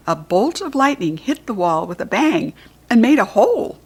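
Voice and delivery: ordinary delivery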